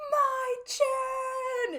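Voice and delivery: very high, loud, drawn-out cry; imitated full-lung scream. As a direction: scream the name like an overjoyed friend. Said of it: "screams at the top of his lungs".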